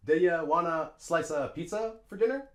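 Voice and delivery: linked "duh-yuh wanna slice-uh pizza fer dinner" in clear groups; reduced schwas with small gaps between chunks; native reductions, spelled out. Shown as a demonstration. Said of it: "A native English speaker would say".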